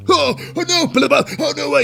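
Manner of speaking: high, loud mock whimper of a flailing wrestler; mock panicky wrestler. Vlogger mocks McIntyre flopping at the table, high mock panic. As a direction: squeal it like a big man faking pain.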